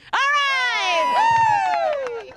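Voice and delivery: loud high whoop of excitement; excited shout. Real, not acted. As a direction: cheer the finish at full voice. Winner finishes the contest.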